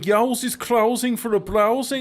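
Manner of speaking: high, mock Brummie accent. Retelling what the Brummie said to him, Brummie accent. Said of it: "I was talking to a Brummie".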